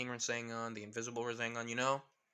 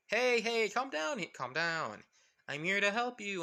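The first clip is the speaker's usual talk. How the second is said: high, loud opening, then easing; acted raised-voice reassurance; urgent placating; 7 semitones higher, 6 dB louder than usual. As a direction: call out to calm them down. Figure placating alarmed students.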